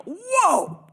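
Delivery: sudden loud high shout; loud high-pitched yell of whoa. Retold or imitated. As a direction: shout to stop him from erasing. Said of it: "I yell".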